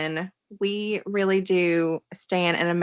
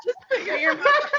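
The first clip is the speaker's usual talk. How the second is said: laughing. Real, not acted. Laughing joke.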